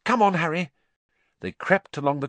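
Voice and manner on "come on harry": higher-pitched, pressing call; tense urging. A narrator's reading. Hermione hurries Harry along the corridor, tense. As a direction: urge a friend onward.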